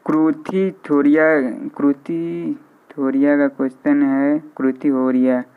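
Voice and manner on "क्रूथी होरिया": ordinary talk, nothing marked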